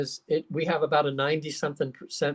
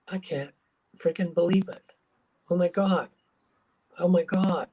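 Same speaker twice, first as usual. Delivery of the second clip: a touch of awed, breathless disbelief; stunned disbelief; 3 semitones higher than usual. Retold or imitated. Client staring at ketchup, stunned disbelief.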